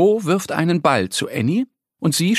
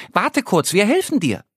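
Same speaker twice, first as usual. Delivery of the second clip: higher duck voice calling up the tree; 7 semitones higher than usual. Acted. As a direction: reassure the squirrel from below.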